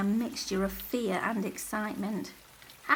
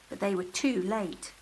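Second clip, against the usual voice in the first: ordinary delivery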